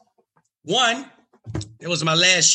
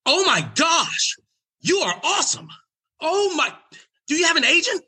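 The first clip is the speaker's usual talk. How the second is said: much higher, breathless, thrilled; gushing agent. Retold or imitated. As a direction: rave like you found a star. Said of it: "His agent runs up to me".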